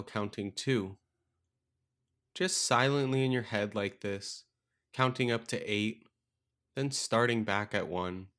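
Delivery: calm meditation guide with long, restful pauses; guided meditation. Acted. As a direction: guide them gently into stillness, leaving space between phrases.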